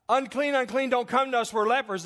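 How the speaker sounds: high, urgent warning call; imitated crying out. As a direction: call out like outcasts warning people away. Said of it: "they didn't cry out".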